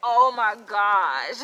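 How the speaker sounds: a loud, drawn-out "oh my gosh"; stunned friend, slow and loud; shocked gasp. Retold or imitated. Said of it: "she was so shocked".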